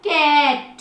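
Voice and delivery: कैट with the "ai" held very long before the T; long vowel. Shown as a demonstration. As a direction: stretch the middle of कैट. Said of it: "इस pronunciation को कितना लंबा खींच रही हूँ". (कैट में "ऐ" को लंबा खींचकर)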